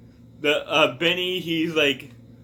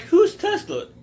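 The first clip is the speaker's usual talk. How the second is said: clueless companion; 5 dB louder than usual. Retold or imitated. Companions clueless about Tesla.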